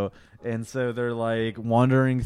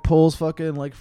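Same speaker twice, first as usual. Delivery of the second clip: ordinary talk, nothing marked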